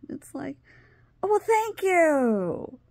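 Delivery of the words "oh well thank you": high, drawn-out sarcastic thanks; mock grateful. Patient answering the useless doctor, mock grateful. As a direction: stretch it out, pitched way up. Retold exasperated reply to doctor.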